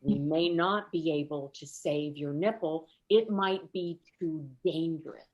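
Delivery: ordinary talk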